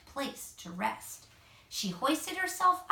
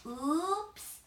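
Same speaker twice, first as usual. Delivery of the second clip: a slightly higher, sheepish little oops; 5 semitones higher than usual. A narrator's reading. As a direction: own up to the mishap, a little embarrassed. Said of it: "she said sheepishly".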